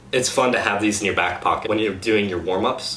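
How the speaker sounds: ordinary talk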